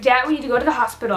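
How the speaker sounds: quick, urgent rush of words; hurried retold plea. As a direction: rattle it off as if rushing to dad.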